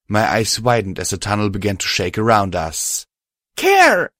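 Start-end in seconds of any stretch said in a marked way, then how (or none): 3.6-4.1 much higher, louder cry of a name; anguished cry. Acted. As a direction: cry out in horror. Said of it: "I cried".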